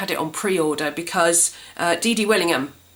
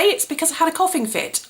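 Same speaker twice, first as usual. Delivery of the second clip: normal talking voice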